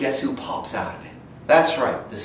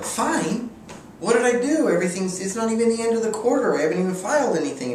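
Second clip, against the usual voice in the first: much higher, frantic, rushing panicked protest; alarmed disbelief; 12 semitones higher than usual. Retold or imitated. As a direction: squeal it like you just got fined for nothing. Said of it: "I was like".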